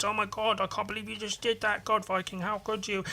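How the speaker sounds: high, hushed horrified disbelief; mock shock. Shocked cultists to God Viking, high dismayed voices. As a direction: reproach him in a high, softened voice. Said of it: "They're all shocked".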